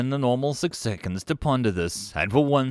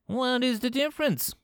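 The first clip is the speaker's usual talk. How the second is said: high, anxious question; a touch of fear in a high voice; note of fear. A narrator's reading. Five Clicks asks about dark chuckling, fearful. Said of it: "a note of true fear in his voice".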